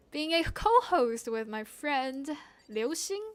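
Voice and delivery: usual speaking voice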